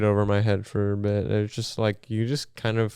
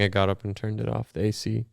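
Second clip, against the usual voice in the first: ordinary talk, nothing marked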